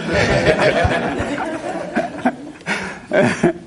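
breathy, high nervous laugh; nervous giggle. Real, not acted. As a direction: giggle it out, embarrassed. Nervous laugh after teasing.

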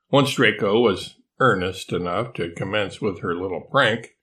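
ordinary delivery

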